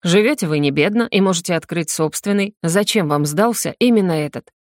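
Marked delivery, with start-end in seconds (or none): none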